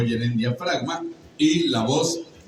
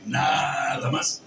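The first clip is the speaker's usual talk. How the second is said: drawn-out rasp; slower than usual. Shown as a demonstration. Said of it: "le puse cómo se prolonga la voz".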